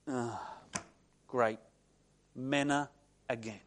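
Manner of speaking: low, soft, deflated grumble; weary disappointment. Retold or imitated. Israelite opens tent to find manna, weary disappointment. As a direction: sigh it out with mock dismay.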